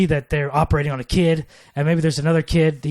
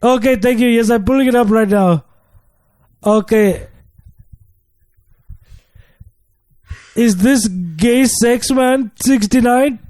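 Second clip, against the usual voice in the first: a light put-on African accent, pitched higher; call-center agent in a lightly done African accent; mock African accent, slightly high; 5 semitones higher than usual. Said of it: "I couldn't do my African accent".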